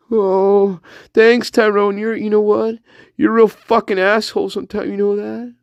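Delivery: high-pitched comic reproach; affronted spirit guide. Pretend. Spirit guide calls out Tyrone, affronted high voice. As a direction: scold in a high, wounded voice.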